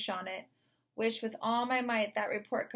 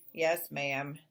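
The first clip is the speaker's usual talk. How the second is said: grumbling; 6 semitones lower than usual. A narrator's reading. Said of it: "Davis grumbled".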